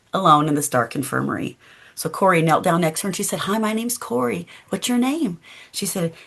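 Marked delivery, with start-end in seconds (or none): 3.4-5.4 higher, softer and tender; gentle to a child. Acted. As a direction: coax a scared little girl kindly. Corrie greets a frightened child.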